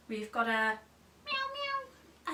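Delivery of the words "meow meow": cat meow. Shown as a demonstration. Cat noise for toddlers.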